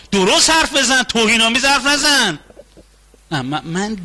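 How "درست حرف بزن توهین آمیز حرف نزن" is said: offended scolding. Acted.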